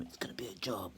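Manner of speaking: unvoiced worried whisper. Toby whispers a warning to his family. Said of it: "he whispered to them".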